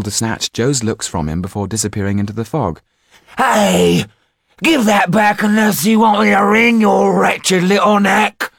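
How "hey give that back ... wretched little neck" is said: high, loud furious shout; furious cry. A narrator's reading. Joe yells after the girl who stole his eye, furiously. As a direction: threaten to wring her neck. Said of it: "cried Joe".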